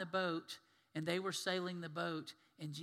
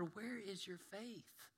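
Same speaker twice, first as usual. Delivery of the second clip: soft challenge; 4 semitones higher, 9 dB quieter, much slower than usual. Retold or imitated. Jesus ends rebuke softly.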